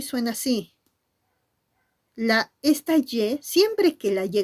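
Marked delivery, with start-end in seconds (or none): none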